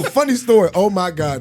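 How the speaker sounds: ordinary talk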